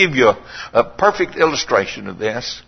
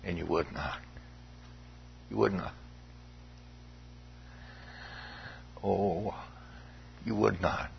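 softer, lower, nearly breathed; hushed sorrow; 8 semitones lower, 19 dB quieter than usual. Real, not acted. Preacher lingering sadly on the last phrase, hushed. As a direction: let it fall away to almost nothing. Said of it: "notice the last phrase".

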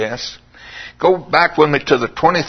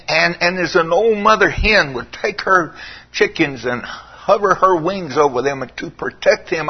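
usual speaking voice

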